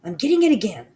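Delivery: fast, loud, high urgent call; urgent cry. Acted. Mildred orders everyone still, fast and loud. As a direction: rush it out to freeze the room. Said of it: "cried the mitch called Mildred".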